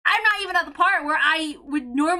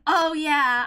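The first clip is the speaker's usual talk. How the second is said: normal talking voice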